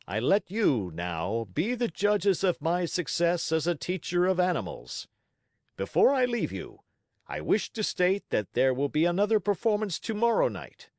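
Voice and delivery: high, projected showman's speech; grand, self-praising circus patter; ringmaster addressing the crowd. Acted. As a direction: proclaim to the whole circus audience like a proud ringmaster.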